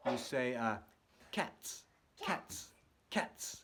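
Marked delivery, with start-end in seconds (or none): 1.3-3.6 cats breathed out without voice; unvoiced cats, beatbox style; whispered cats. Shown as a demonstration. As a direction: whisper cats as a snare beat. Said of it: "where you say".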